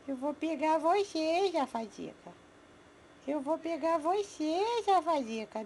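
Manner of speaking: put-on high sing-song baby talk to pets. Owner cooing to her pets in baby talk. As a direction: coo at the little animals like a doting mom.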